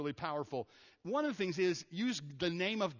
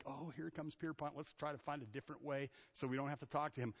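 muttered, much softer, avoiding someone; mock grumbling aside; 2 semitones lower, 7 dB quieter than usual. People dodging the preacher, softly muttered. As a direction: grumble it under your breath to a friend. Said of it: "people were like".

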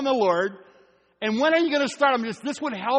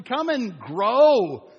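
drawn-out call; 4 dB louder, much slower than usual. Acted. Emphatic closing call.

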